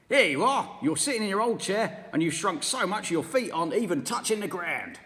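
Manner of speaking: sneering husband, loud; mean old husband taunting his wife; Mr Twit. Acted. Mr Twit shouted.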